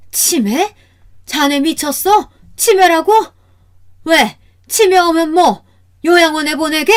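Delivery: high, loud indignant challenge; outraged retort. Acted.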